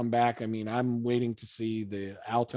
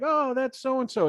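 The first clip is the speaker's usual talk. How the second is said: excited recognition; 10 semitones higher than usual. Pretend. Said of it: "you're like".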